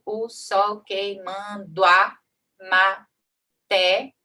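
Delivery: very slow, each syllable pulled apart; syllable count. Shown as a demonstration. Teacher counts syllables aloud for students, drawn out slowly. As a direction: stretch "só Jesus é testemunho" out one poetic syllable at a time. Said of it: "A gente só conta até o té".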